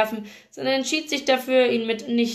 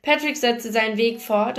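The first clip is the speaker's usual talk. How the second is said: ordinary delivery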